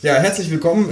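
normal talking voice

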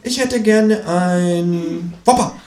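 langsam, lachend gedehnt; sturer Känguru. Acted. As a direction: stur und amüsiert beharren. Känguru wiederholt stur, lachend. (langsam, stur und lachend)

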